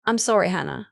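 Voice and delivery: usual speaking voice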